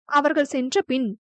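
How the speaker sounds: usual speaking voice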